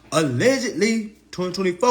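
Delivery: high, loud, knowing emphasis; winking hedge. Real, not acted. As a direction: hit it high and loud like an open secret.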